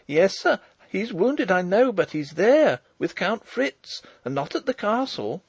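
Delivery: put-on high, insistent young girl; persisting. A narrator's reading. Farm girl contradicting the old marshal, persisting. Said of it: "she persisted".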